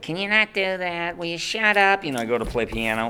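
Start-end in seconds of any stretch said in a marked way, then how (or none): 0.0-2.0 annoyed adults. Retold or imitated. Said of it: "everybody was like".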